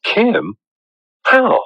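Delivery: high, startled questions; can't believe what he hears; astonished disbelief. Acted. Hears Kim cured the boy.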